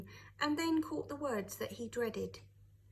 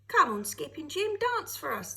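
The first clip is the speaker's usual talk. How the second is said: louder, eager teasing call; shouted; 4 semitones higher, 7 dB louder than usual. A narrator's reading. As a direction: egg him on to dance. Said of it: "they shouted".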